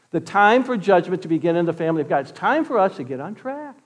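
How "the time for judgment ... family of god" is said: normal talking voice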